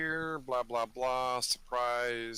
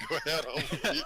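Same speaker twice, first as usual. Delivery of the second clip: much higher, laughing through the words; laughing repeat; 4 dB louder than usual. Real, not acted. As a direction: echo the line while cracking up. Repeats partner's line, laughing.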